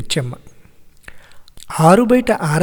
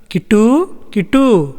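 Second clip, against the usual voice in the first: drawn-out searching call, raised pitch; 4 semitones higher, slower than usual. A narrator's reading. Grandmother looks for Kittu on the veranda, calling out. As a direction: call the name, rising then falling. Grandmother calls for missing boy.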